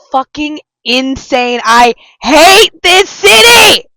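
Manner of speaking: high, slow furious yell; angry shout. Real, not acted. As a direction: yell it out in outrage. Outburst, loud, slow, high.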